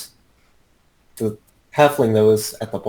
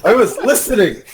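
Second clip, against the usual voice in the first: high protest through laughter; laughing; 12 semitones higher than usual. Real, not acted. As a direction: insist while laughing. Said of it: "You were playing Smash Bros".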